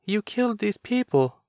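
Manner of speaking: put-on high child's voice, stunned; a small son accusing his father; frightened little boy's voice. A narrator's reading. Child character speaks.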